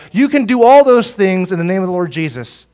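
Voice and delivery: rising sermon exhortation; emphatic preaching. Real, not acted. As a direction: preach it out with force.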